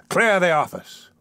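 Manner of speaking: high-pitched dismissive order; commanding. A narrator's reading. Magistrate closing the case, commanding. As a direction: call it out to end the matter.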